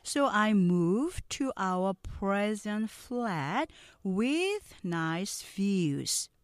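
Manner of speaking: very slow, carefully sounded English lines; slow model reading. Shown as a demonstration. Teacher reading slowly for students to repeat. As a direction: read each sentence slowly for repeating. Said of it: "느린 속도 듣고 따라해".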